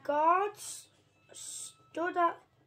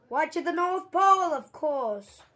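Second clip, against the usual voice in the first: proud guard; 2 semitones higher, 9 dB louder than usual. Acted. Guard telling the boy their destination, proud and loud. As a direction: announce it like it's obvious.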